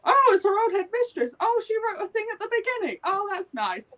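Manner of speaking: high, fast, surprised recall; mock surprise. Reader remembering the headmistress, fast high surprise. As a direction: gush out the sudden realisation, quickly. Her own surprised reading reaction.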